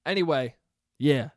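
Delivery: ordinary talk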